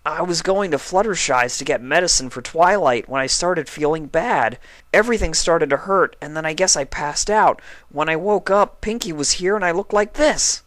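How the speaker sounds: lightly voiced young dragon recounting; puzzled storytelling; Spike explaining. A narrator's reading. Spike telling Applejack what happened, lightly voiced.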